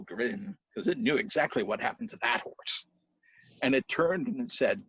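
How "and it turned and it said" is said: ordinary delivery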